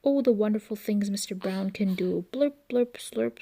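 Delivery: plain reading voice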